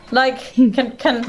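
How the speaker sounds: normal talking voice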